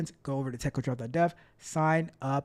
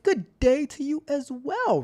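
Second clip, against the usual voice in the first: high, slow, louder mock-formal reply; hammed-up greeting; 11 semitones higher, 6 dB louder than usual. Streamer greeting a viewer back, high and hammed-up. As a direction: return the greeting with a playful flourish.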